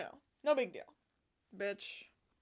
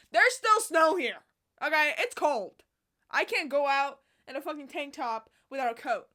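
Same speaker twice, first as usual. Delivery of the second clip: mock rant; 3 semitones higher, 12 dB louder than usual. Real, not acted. Vlogger ranting at viewers about Canadian winter, loud. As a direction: vent at them with mock outrage.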